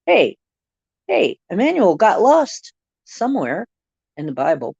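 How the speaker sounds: ordinary delivery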